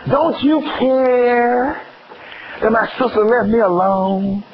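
high, drawn-out plaintive wail; mock complaining Martha. Preacher acting Martha griping to Jesus, mock whine. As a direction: drag out the complaint, high-pitched.

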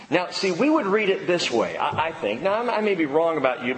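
ordinary delivery

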